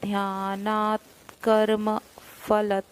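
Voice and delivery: "ध्यानात् कर्म" very slowly, half t joined to k; slow joined pronunciation. Shown as a demonstration. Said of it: "जोड़ के बोलेंगे".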